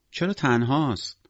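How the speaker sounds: put-on high, slow child's question; drawn-out, high childish voice; curious little girl. A narrator's reading. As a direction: wonder aloud like a four-year-old. Little girl asks about penguin.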